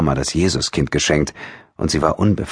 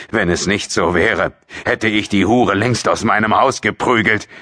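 father's angry retort, voice raised in pitch; angry call; 5 semitones higher than usual. A narrator's reading. Father answering his wife's plea about their daughter, angry call. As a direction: call it out in contempt. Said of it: "rief José".

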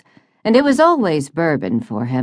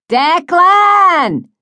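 shrill-pitched, drawn-out call in a faux voice; high-pitched yell; 7 dB louder than usual. A narrator's reading. Friend on deck calling down to him, high-pitched yell. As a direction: yell the name down, high and loud. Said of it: "high-pitched voice yelled down to him".

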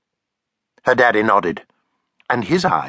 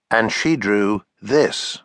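ordinary delivery

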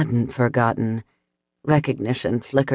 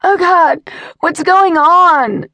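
panicked scream; 7 dB louder, slower than usual. Acted. Woman knocked down, panicking.

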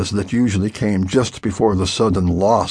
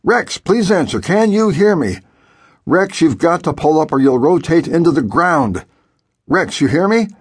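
raised, higher pleading calls over the radio; urgent radio calls; 6 semitones higher than usual. A narrator's reading. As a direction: call out urgently to a pilot who won't answer. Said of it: "kept calling over the radio".

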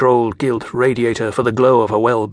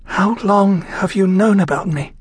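voice shaking; 7 semitones higher than usual. A narrator's reading. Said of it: "Her voice shook".

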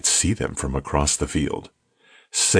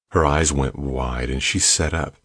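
plain reading voice

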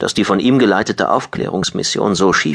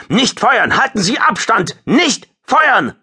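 high, desperate shouted command; screamed order; 4 dB louder, slower than usual. A narrator's reading. Squadron leader restraining his pilots, screaming. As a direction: yell to stop them attacking. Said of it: "schrie Hartfield".

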